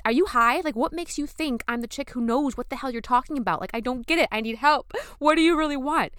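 loud, high, exasperated rant; flipping out. Retold or imitated. Wife snapping at her husband on the job site, flipping out. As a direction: fire it back at him in disbelief. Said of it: "Flipping out".

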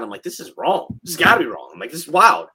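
ordinary talk

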